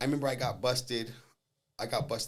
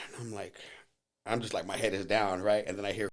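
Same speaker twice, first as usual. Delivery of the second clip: ordinary talk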